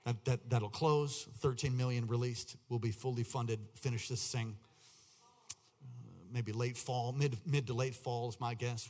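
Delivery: ordinary talk, nothing marked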